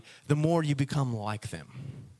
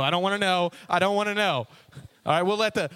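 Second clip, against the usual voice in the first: ordinary delivery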